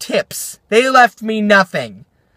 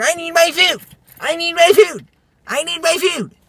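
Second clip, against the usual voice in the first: high, whiny repeated demand; hammed-up high-pitched tantrum; mock yelling; 11 semitones higher than usual. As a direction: mimic teens throwing a tantrum, pitched way up. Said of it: "they're yelling at me".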